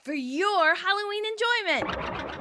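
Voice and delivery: high, slow theatrical presenting; spooky show announcer. Acted. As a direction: present it grandly, drawing out the words. Announces a Halloween skit.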